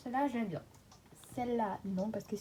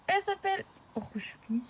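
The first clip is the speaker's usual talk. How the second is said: "Rouge Pif" read much higher, in a squeaky put-on voice; misreading a name; 9 semitones higher, 4 dB louder than usual. Real, not acted. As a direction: chirp "Elle s'appelle Rouge Pif" up high.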